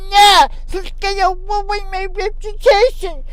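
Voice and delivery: high, loud whiny mock panic; mimicking his fear in a high voice; mock panicked man. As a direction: squeak it out like a frightened snob. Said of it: "he was like".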